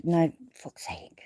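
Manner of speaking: slow, whispered curse under the breath; frustrated whisper. Real, not acted. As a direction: mutter it to yourself in annoyance. Frustrated aside while fumbling link.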